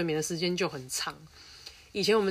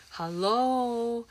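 mocking hello; 5 semitones higher, much slower than usual. Real, not acted. Mocking the school's logic.

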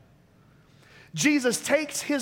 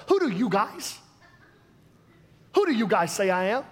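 laid-back West Coast accent, you guys; easygoing West Coast surfer tone; light West Coast accent. Shown as a demonstration. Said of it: "Let me go West Coast".

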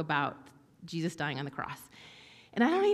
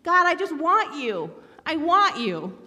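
high, louder, slow plea; little girl's longing; 8 semitones higher, 6 dB louder, slower than usual. Retold or imitated. Three-year-old praying in bed, high longing plea. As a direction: plead it like a small child. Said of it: "And I was like".

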